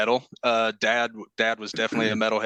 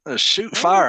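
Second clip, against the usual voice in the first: hammed-up Southern drawl; 8 semitones higher, 6 dB louder, slower than usual. Exaggerated southern exclamation.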